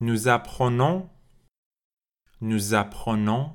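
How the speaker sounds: slow, clear model forms with pauses, linking the s; repeat-after-me drill. Shown as a demonstration. Teacher drilling apprendre with liaison for learners to repeat, clear. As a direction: model each form so the class can echo it. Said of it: "Répétez après moi".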